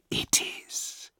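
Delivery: cold, unearthly whisper; eerie goddess whisper. Acted. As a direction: breathe it like a ghost goddess. Goddess speaks coldly.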